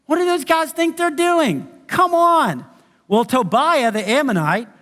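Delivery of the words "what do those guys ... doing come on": high, scornful jeer; mocking. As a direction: sneer at the builders from up high. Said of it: "he starts mocking them".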